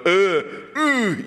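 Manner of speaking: effortful grunts lifting a friend; mock straining grunts. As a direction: strain as if hauling someone up.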